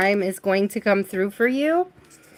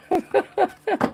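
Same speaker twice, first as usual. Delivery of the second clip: high giggle; 9 semitones higher than usual. Real, not acted. Laughs high.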